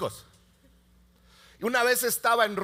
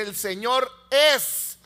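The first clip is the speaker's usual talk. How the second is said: scripture reading; 6 semitones higher, 4 dB louder than usual. Acted. Pastor reading Psalm 103 to parents, proclaiming.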